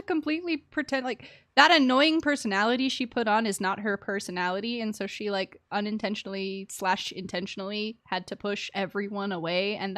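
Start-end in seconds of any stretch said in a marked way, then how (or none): none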